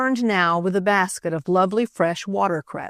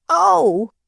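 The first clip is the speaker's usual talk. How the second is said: sudden high startled Oh, louder; frightened cry; 10 semitones higher, 6 dB louder than usual. A narrator's reading. Little boy startled by a toad, frightened cry. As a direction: jump back from the toad with a cry. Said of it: "he cried, frightened".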